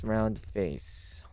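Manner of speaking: usual speaking voice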